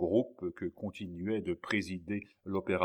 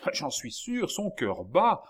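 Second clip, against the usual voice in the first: high, loud, urgent assurance; excited insistence; 8 semitones higher, 5 dB louder, slower than usual. A narrator's reading. Young man feeling the boy's heart beat, excitedly insisting.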